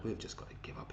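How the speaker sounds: resigned whisper to the viewers; breathy conspiratorial aside. Real, not acted. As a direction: give up on him under your breath. Resigned aside about Haaland.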